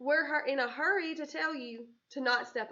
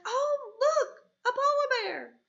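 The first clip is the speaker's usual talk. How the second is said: much higher, louder delighted exclamation; excited; 12 semitones higher, 8 dB louder than usual. Shown as a demonstration. Teacher showing an exclamation to the class, excited. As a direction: gasp out the sighting with delight. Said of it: "it's also not an exclamation mark 'cause it's like".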